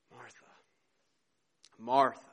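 tender, heartfelt appeal, left hanging. Acted. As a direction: gently call her name, pausing between. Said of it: "again, very tenderly, he says".

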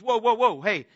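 retold man's startled objection; alarmed protest. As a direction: pull back in sudden alarm. Man alarmed at angel's offer.

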